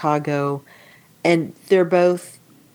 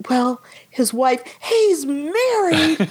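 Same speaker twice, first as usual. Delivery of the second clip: high, drawn-out wail through a laugh; mock sobbing; 8 semitones higher, much slower than usual. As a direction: imitate a weeping wife, hammed-up. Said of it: "she's sobbing and sobbing".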